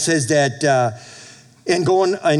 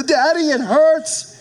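put-on high, slow whimpering little boy; mock crying child; 11 semitones higher, 3 dB louder, slower than usual. Pastor voicing his crying son to the congregation. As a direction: plead like a hurt toddler. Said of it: "He cried".

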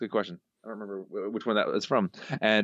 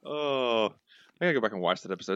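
ordinary talk

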